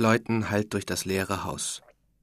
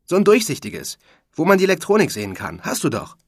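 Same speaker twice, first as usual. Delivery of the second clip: high, loud and fast, words tumbling out; can't wait to share it; excited; 9 semitones higher, 10 dB louder than usual. A narrator's reading. Rocky tells Niklas his news on the phone, excited and fast. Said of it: "sagt Rockys Stimme aufgeregt".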